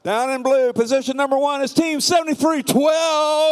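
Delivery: high, hyped-up arena announcer. Acted. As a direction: pump up the crowd announcing teams. Hypes the match line-up.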